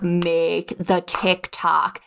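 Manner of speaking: very slow, drawn-out inner urging; gut voice. Retold or imitated. Her gut urging her to post, very slow and insistent. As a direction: let the gut speak slowly, insistently. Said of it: "But my gut was like".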